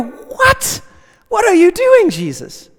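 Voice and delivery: high, baffled outcry; confused reaction. Retold or imitated. Preacher voicing a confused listener questioning Jesus, baffled. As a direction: sound completely puzzled. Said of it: "you should go".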